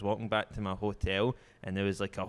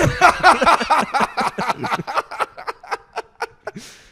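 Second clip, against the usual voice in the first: long, high, loud cackling laugh; 13 semitones higher, 12 dB louder than usual. Real, not acted. Teller laughs at the robber worrying she'll get robbed, long loud laugh. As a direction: laugh helplessly. Laughs at the failed wheelchair plan.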